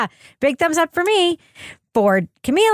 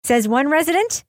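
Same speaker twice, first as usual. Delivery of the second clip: usual speaking voice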